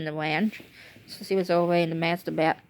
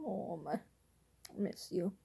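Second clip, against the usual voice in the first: tearful; 15 dB quieter than usual. Real, not acted. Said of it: "I miss her".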